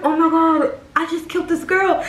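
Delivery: high-pitched panic; retold horror at what just happened; panicked. Panics after customer passes out.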